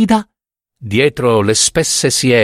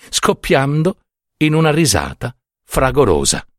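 usual speaking voice

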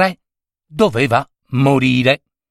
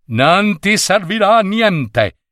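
put-on old wizard; 7 semitones higher than usual. Wizard rebuts king.

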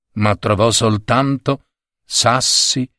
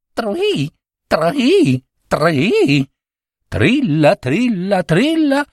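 high chirping cricket trill; 9 semitones higher, much slower than usual. Acted. Narrator trilling as the cricket in the night. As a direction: sing it like a tiny cricket.

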